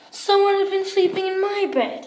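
high, quick bear complaint at moderate volume; Mama Bear's middle-sized voice. Acted. Mama Bear finds her bed slept in, middle-sized voice. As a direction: pitch it up without booming. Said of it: "in her middle-sized voice".

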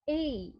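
এই said as a gliding ay sound. Shown as a demonstration. Teacher models the ay sound for students. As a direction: glide the vowel in এই from e to i. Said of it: "উচ্চারণ হবে এই".

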